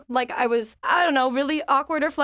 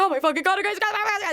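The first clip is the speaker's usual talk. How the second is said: excited squeal; 8 semitones higher than usual. Retold or imitated. Spotting a favorite cosplayer.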